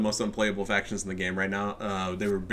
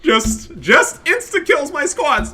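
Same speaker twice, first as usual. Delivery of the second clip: high, loud, slow wailing whine; mocking crying opponent; 15 dB louder, much slower than usual. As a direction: wail it like a crybaby complaining. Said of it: "uh, Bishop".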